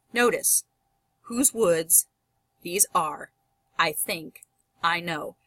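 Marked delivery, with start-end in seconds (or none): 1.2-5.4 "Whose woods / these are / I think / I know" with a pause after each pair; split into feet. Shown as a demonstration. Teacher shows four feet in the line, "Whose woods... these are... I think... I know" in pairs. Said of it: "There are four sets of feet there".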